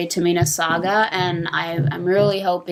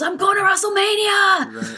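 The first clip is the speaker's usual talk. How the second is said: imitating a pumped wrestler, pitched way up; excited quote; 12 semitones higher than usual. Quoting Dolph Ziggler's first words, high and thrilled. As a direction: mimic his high, thrilled cry of joy. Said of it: "The first thing he said was".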